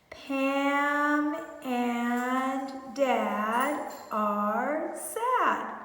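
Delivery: Pam and Dad stretched very slowly while pointing; very slow pointing read. Shown as a demonstration. Teacher reading while pointing, word by word, very slowly. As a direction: drag the words out while pointing. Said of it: "Make sure you're pointing".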